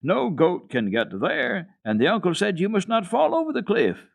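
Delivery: high, loud warning; boy warning a girl off the cliffs; disapproving. A narrator's reading. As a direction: forbid it firmly. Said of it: "with strong disapproval".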